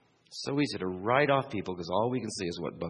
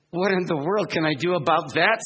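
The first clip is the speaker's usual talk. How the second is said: higher, louder mock exasperation; exasperated Charlie Brown; 7 semitones higher, 7 dB louder than usual. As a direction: plead in mock despair. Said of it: "says Charlie Brown".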